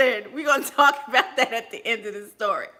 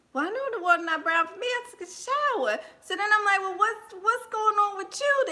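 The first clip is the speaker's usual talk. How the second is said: higher, puzzled; inner dialogue; 6 semitones higher, 4 dB quieter, faster than usual. Retold or imitated. Comedian reasoning with herself about the brown water, higher. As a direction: voice your own private puzzlement, higher.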